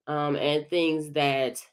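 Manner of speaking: normal talking voice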